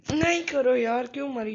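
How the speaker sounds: very loud, high wail; real cry of despair; anguished yell. Real, not acted. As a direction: scream in protest as you die. Killed again in game.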